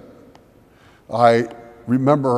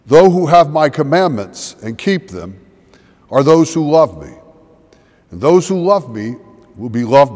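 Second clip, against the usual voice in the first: measured, louder formal Gospel reading; scripture reading; 5 dB louder than usual. Acted. Pastor reading the Gospel to the congregation, formal proclamation. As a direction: proclaim each phrase to the congregation. Reads the Gospel passage aloud.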